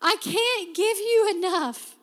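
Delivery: high, loud, emotion-choked "God, I can't give you enough"; breaking with emotion; overwhelmed cry to God. Retold or imitated. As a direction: cry out to God, overcome with gratitude. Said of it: "you're like".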